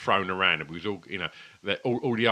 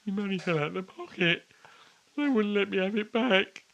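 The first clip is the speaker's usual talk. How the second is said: put-on high, whiny retelling of a tearful child; imitating his younger self close to tears; upset kid; 13 semitones higher than usual. Man retelling the fair to his friend, mock-upset childish whine. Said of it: "getting all upset".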